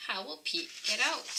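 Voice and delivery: usual speaking voice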